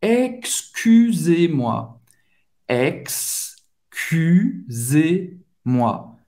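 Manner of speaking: very slow, syllable by syllable; painstakingly slow French demo; slow model pronunciation. Shown as a demonstration. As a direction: break it into ex-cu-sez-moi, stretched out. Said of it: "É assim que a gente vai falar".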